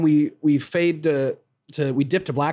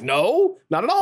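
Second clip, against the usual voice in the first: mock high denial; 7 semitones higher than usual. Nenji denies feelings, retold scene.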